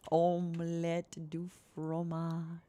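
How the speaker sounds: slow whispered French accent; breath-only French accent, drawn out; soft French accent. Shown as a demonstration. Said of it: "in a soft French accent voice".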